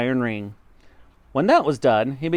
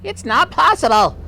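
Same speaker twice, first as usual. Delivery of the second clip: high, loud cry of disbelief; wife's protest; 13 semitones higher, 7 dB louder than usual. Acted. Wife protesting in quarrel.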